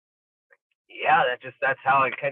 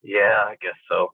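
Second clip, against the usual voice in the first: grudging agreement; 5 semitones lower, 3 dB louder, slower than usual. Retold or imitated. Grudging reply to coach.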